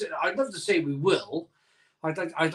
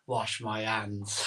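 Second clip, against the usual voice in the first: Lane impression; 7 semitones lower, 8 dB quieter, slower than usual. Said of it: "I'm like Lane".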